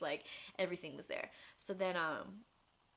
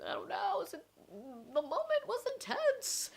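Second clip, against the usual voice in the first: whiny self-quote; 13 semitones higher, 4 dB louder, slower than usual. Retold or imitated. Telling parents why she signed.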